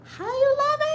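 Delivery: high sung-out greeting to the viewers; sing-song greeting. Real, not acted. Greeting the livestream viewers as they join, in a high sing-song. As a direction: call out to the live chat in a high sing-song.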